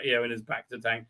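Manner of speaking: ordinary talk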